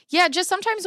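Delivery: talking through a yawn. Real, not acted. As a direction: yawn while you speak. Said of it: "I'm yawning".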